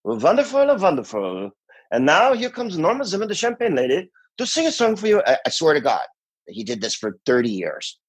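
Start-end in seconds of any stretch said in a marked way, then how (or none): 0.0-5.2 high-pitched cod Scandinavian bandleader. Retold or imitated. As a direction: introduce the act in a mock Scandinavian lilt. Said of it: "hilarious cod Scandinavian accent".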